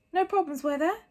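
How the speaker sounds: lightly voiced concerned headmaster; old wizard. A narrator's reading. Dumbledore asks Hagrid.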